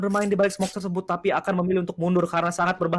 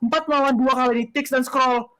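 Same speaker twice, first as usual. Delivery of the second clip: fighting game announcer; 9 semitones higher, 4 dB louder than usual. Real, not acted. Caster hype on clutch triple kill.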